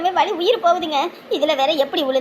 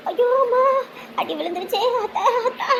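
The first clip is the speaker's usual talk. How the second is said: pained cry; 6 semitones higher than usual. Acted. Hit by hammer.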